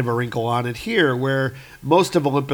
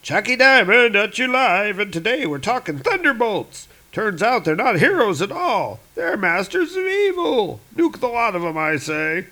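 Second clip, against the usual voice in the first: much higher brash talk-radio shock jock; hammed-up radio announcer; radio host; 8 semitones higher than usual. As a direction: sell the outrage to listeners. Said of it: "a clip of the Chucky Diamond show".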